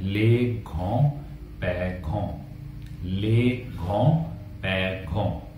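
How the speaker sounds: les grands-parents spelt out slowly and softly, ले घों पैघों; French model word. Shown as a demonstration. Teacher modelling les grands-parents for Hindi learners, slow. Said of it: "को French में कहते हैं".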